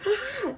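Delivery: very high-pitched scream; overwhelmed scream. Retold or imitated. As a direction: squeal as if it is all too much. Mock scream at overwhelming recap.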